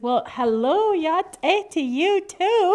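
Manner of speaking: high, loud bird voice; pointed greeting. Acted. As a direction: return the greeting loudly and pointedly.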